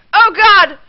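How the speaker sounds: sudden loud, high panicked cry; panicked scream. Real, not acted. Gamer screaming at her crashing rider, panicked.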